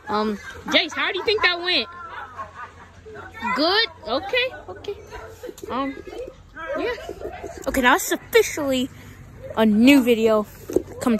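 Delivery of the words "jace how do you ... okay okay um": ordinary talk, nothing marked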